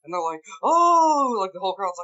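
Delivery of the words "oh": crowd gasp. Retold or imitated. Crowd reacting to crit hit.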